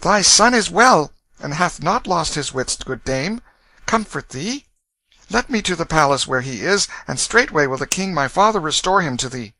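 lightly voiced high boy, reassuring; gentle young prince. A narrator's reading. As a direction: comfort her with courteous royal assurance. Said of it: "and said gently".

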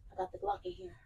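unvoiced conspiratorial whisper; mock gangster whisper. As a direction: let them in on a secret, hushed. Whispered joke.

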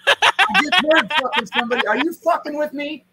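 high, loud, laughing paranoid victim; hammed-up, high-pitched panic; mock freak-out. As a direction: act out a rattled accusation through laughter. Acting out spooked victim, laughing.

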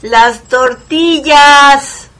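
slow loud drawn-out call. Retold or imitated. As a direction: call it across the house. Mother calling out slowly.

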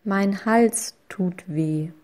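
ordinary delivery

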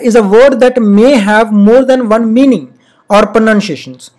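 very slow reading of the definition; slow classroom dictation. Shown as a demonstration. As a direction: read it out slowly for the class. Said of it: "reading the definition slowly".

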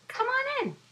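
high squeaky little fly; a tiny insect inviting guests. Acted.

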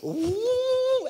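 loud, very high sustained "woo"; imitated spinning whir. Man spinning inside like a blender, whirring whoop. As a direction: whoop like a blender at top speed. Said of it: "I began to spin inside".

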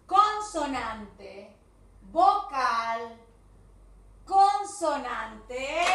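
loud, slow drilled rule; loud teacher chant, every word stretched; voice-straining emphasis. Shown as a demonstration. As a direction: hammer each term out slowly. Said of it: "porque casi me quedo sin voz".

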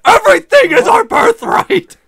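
building to a shouted rant; villainous rant. Pretend. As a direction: rise into a villain's triumphant shout.

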